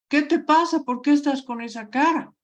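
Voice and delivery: pregunta alta y sorprendida; pregúntalo preocupado, subiendo el tono. Shown as a demonstration. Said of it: "y te pregunta". (pregunta sorprendida, más aguda)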